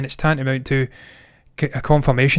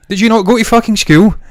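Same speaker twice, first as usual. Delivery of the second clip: exasperated; 7 semitones higher, 7 dB louder than usual. Real, not acted. Exasperated rant about mispronunciation.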